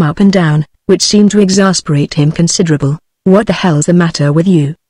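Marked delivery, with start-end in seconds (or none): none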